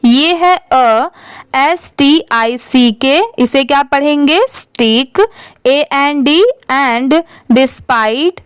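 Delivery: normal talking voice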